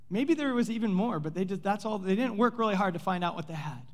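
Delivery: ordinary talk, nothing marked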